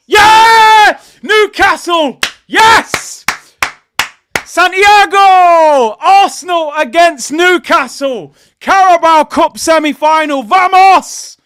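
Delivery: screaming with joy, very high and loud; real jubilant shouting; ecstatic celebration. Real, not acted. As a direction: roar it like your team just scored. Celebrating the draw result live.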